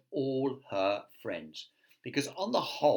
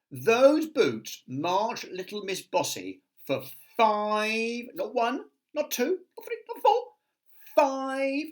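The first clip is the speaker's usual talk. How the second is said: building excitement; 12 semitones higher, 6 dB louder than usual. A narrator's reading. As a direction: pitch climbing higher and higher. Counting up the miles.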